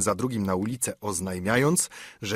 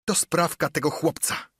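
furious cry; 4 semitones higher than usual. A narrator's reading. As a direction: spit out his hatred of the boy. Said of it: "krzyczał ślepy żebrak Piu". (wściekły krzyk ślepego żebraka)

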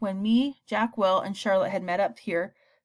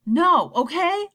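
much higher, louder, slow defiant yell; a son yelling back at his father; 9 semitones higher, 7 dB louder, much slower than usual. Acted. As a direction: hurl it back at him. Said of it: "I yelled".

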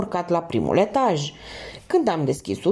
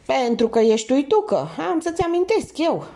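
ironic smile; 7 semitones higher than usual. A narrator's reading. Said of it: "accentuându-și zâmbetul".

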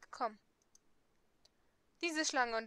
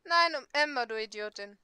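loud retort; 7 dB louder than usual. Acted. Castiel snaps back at her.

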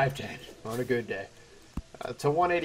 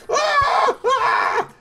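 scream; 9 dB louder than usual. Real, not acted. Dying in game, screaming.

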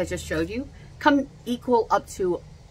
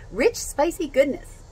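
Rich, spicy goodness said higher and slower, savoring each word; 9 semitones higher, 3 dB louder than usual. Shown as a demonstration. Repeating the phrase to viewers, higher and savoring. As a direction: relish Rich, spicy goodness like a tasty ad line.

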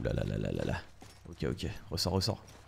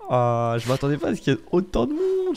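flustered exclaiming; 8 semitones higher, 5 dB louder than usual. Real, not acted. Overwhelmed by enemies.

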